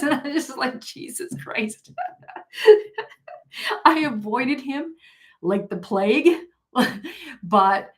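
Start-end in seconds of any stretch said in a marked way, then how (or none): 0.8-1.9 slow, breathless whisper of disbelief; a stunned, slow whisper; whispered aside. Retold or imitated. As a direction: mouth it under your breath, aghast. Shocked reaction during the hug.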